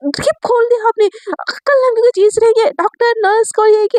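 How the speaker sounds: high, rushed panicked scolding; alarmed high-pitched scolding. A narrator's reading. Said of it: "घबरा के चिल्लाने लगती है".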